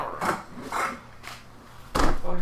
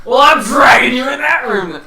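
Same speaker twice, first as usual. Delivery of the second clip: louder, firm declaration; forceful shout; 5 semitones higher, 11 dB louder than usual. Acted. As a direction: shout it with determination. Character forcing player.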